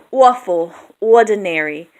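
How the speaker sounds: "Awful, ordinary" loudly with oo-ah openings; ua diphthong. Shown as a demonstration. Giving other examples, "Awful, ordinary" as oo-ah-ful, oo-ah-dinary. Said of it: "it's a ua though".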